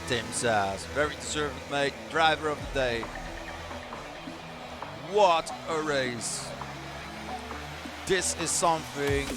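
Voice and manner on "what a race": drawn-out. Acted. Slow drawn-out call.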